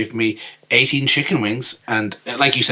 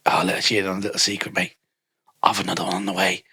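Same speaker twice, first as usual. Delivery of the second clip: low, softer mock English accent; hushed, lightly put-on English voice; confiding English bloke; 4 semitones lower, 6 dB quieter than usual. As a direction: share it like a secret, imitating him. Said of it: "this guy basically told me".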